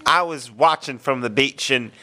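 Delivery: ordinary talk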